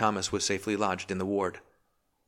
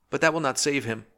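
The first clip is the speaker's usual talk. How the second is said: a higher, louder cry of a frightened wife; lightly acted anxious cry, higher and louder; 8 semitones higher, 4 dB louder than usual. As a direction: blurt it out in fear for him. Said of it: "cried the girl".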